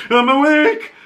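high-pitched startled yelp; startled awake. Retold or imitated. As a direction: blurt it out as if jolted from sleep. Vic jolted awake by banging.

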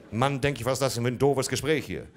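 father fuming at the nosy butcher; exasperated rant. Retold or imitated. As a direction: rant in rising irritation.

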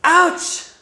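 loud, high playful yelp; mock pain yelp. Teacher acting out a hurt thumb, loud mock yelp. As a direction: cry out as if the thumb is throbbing.

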